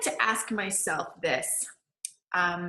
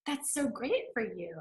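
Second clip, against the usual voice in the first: fake polite; 8 dB quieter, slower than usual. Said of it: "They're like".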